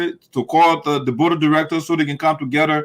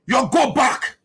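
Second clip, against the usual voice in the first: higher, sharp dismissive order; mock angry order; 5 semitones higher, slower than usual. Mocking the senator ordering the elders back, angry. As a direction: imitate an angry man shooing people off. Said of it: "Slabby is angry".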